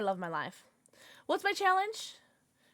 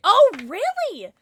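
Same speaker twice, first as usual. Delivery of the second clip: surprised; 8 semitones higher, 8 dB louder, slower than usual. Real, not acted. Surprised reply to chat.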